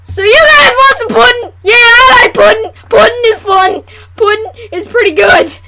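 loud, high mock ad pitch, easing off; hammed-up sales shout. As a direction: holler it like an over-keen pitchman. Mock commercial, excited sales pitch.

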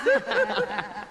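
high cackling laugh; tipsy woman's high cackle; drunken cackle. Acted. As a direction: cackle at your own joke. Drunk woman laughing at her own joke.

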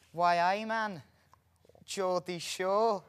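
high, loud mock Geordie; hammed-up Geordie catchphrase voice; Northern accent. Host forced to speak Northern in a game, put-on Geordie accent. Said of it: "I can't do Northern".

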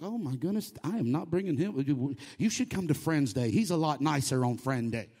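low, understated mock dismay; embarrassed church member. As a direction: drop the pitch into an awkward confidential aside. Voices embarrassed church member inviting guest.

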